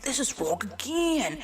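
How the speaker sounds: high mock complaint; complaining customer. Customer finding the order wrong, in a mock complaint. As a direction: whine it like an exasperated customer.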